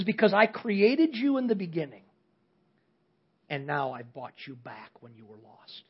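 soft, low and tender. Acted. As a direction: let it fall gently, full of love. Boy speaks to boat, tender climax.

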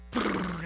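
fluttering lip trill for wings; wingbeat noise. Retold or imitated. Turkey crossing the river, imitated wing flutter. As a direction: trill it like a bird taking off.

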